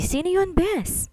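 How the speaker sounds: "sino yun" slow and high, gossipy; nosy surprised friend. Acted. Friend catching a man's voice on the call, slow high gossipy surprise.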